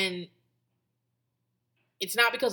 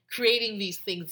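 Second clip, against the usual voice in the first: normal talking voice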